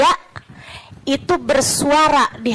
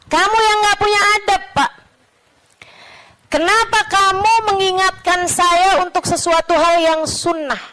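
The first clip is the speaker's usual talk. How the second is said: higher, quicker indignant comeback; heated retort; 9 semitones higher than usual. Retold or imitated. Syekh answering back about manners, heated mock retort. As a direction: throw the reproach back, acting offended.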